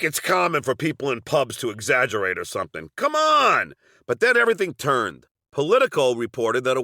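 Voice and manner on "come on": exasperated. Real, not acted. Exasperated aside to listener.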